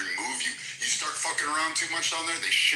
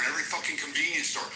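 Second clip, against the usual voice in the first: ordinary talk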